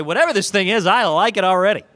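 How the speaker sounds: loud, eager imitated buyer; imagined buyer's delight. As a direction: play the thrilled buyer who loves it on sight. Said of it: "you say".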